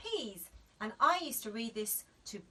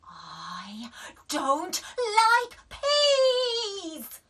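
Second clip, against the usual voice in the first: whisper to wail; 11 semitones higher, 11 dB louder, much slower than usual. A narrator's reading. Daisy breaking into a wail. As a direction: whisper then high loud wail. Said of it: "said Daisy".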